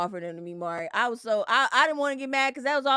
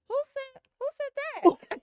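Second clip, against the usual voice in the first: mock high disbelief. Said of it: "'Cause she like".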